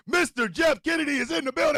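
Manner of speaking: high, loud hype-man announcement; rousing shouted welcome; announcer shout. Real, not acted. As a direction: call out the name like a big introduction. Announcing a friend's arrival to listeners.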